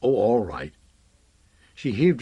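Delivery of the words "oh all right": grudging low growl; a mildly growled concession. A narrator's reading. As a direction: growl it in reluctant surrender. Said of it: "and growled".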